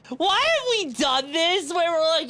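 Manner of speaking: usual speaking voice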